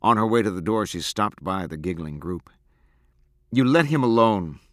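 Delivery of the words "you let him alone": firm, louder warning; cold command, slightly raised. A narrator's reading. Abra warning the giggling group, coldly. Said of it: "she said coldly".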